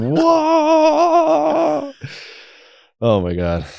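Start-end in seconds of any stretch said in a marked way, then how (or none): 0.0-1.9 long, high imitated "whoa"; overwhelmed wail. Re-enacting that one wild experience, high drawn-out wail. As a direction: hold one high, sustained cry.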